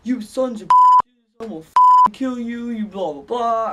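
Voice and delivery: loud, high mock screaming threats; hammed-up furious shout, trailing into blahs; retold scream. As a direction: yell it like a friend hurling abuse. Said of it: "Thomas screams at them".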